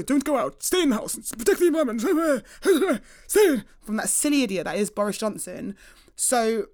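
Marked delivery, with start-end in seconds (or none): 0.0-3.6 a touch of a bossy official, higher, with a laugh at the end; lightly mock the orders; mock lockdown order. Host mocking the government telling people to stay in.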